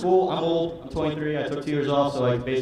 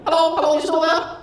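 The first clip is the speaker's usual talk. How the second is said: high, loud impatient caller; squeaky voice checking the line; 13 semitones higher, 8 dB louder than usual. Retold or imitated. Caller on phone again.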